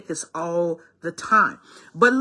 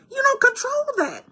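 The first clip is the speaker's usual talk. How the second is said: much higher and drawn; pointed. Real, not acted. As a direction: tell them flatly they have no control. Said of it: "advising the viewer".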